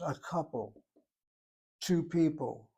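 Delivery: ordinary talk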